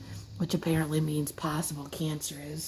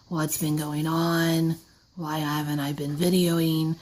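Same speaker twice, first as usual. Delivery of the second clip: ordinary talk, nothing marked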